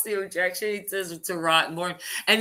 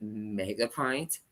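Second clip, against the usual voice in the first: slow, softer deadpan mock question; imitating Johnny Depp; 9 semitones lower, 8 dB quieter, slower than usual. Retelling Depp's question to the lawyer, deadpan. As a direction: draw it out quietly in disbelief. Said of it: "he goes".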